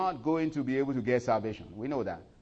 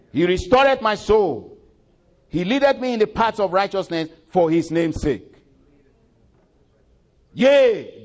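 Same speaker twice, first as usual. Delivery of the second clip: preaching a psalm; 8 semitones higher, 11 dB louder than usual. Acted. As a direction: proclaim the psalm over music.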